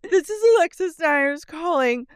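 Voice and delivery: high haughty imitation through laughter; mock voicemail. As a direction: leave it like an offended diva.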